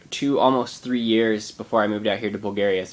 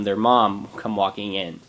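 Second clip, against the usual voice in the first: usual speaking voice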